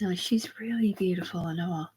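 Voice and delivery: a breathy, voiceless aside under the breath; whispered aside. Real, not acted. Confiding an aside about the girl, whispered.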